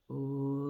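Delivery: long, low drawn-out "ooh"; imitated sloth noise. Imitating a slow sloth for children, long low ooh. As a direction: hold a low, soft, steady "ooh" like a sloth. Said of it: "Or he would be like this".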